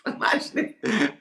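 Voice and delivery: high-pitched laughing words; real laugh bubbling through. Real, not acted. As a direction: burst out laughing as you say it. Laughing at the idea of training.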